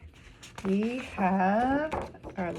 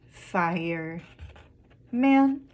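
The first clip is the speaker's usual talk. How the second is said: syllable by syllable; 3 semitones lower, 5 dB louder than usual. Shown as a demonstration. Teacher showing children word parts, broken apart. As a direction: break it into "fire" and a louder "man". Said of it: "count some of the syllables".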